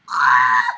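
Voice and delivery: a loud low groan of rage; blowing off steam; short angry shout; frustrated yell. Real, not acted. Frustrated outburst.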